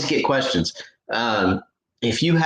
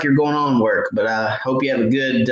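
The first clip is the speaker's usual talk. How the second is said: usual speaking voice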